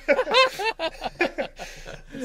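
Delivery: long high cackle of laughter; laughing hard. Real, not acted. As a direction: crack up at your own story. Cracking up at own story.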